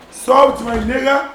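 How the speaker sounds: drawn-out shout across the room; loud call. Real, not acted. Calling out slowly to a friend, loud.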